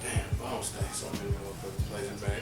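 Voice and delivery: ordinary delivery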